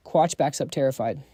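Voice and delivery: usual speaking voice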